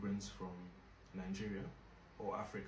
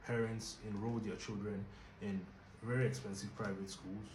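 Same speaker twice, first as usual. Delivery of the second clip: usual speaking voice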